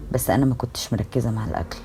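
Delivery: ordinary delivery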